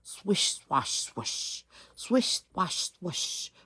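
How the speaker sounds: swishing sounds. Acted. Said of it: "went a big street sweeper".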